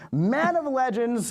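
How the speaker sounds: slow, high announcer build-up; grand announcer. Acted. Host hyping a legend to the audience, grand and slow. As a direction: stretch it like a hype intro.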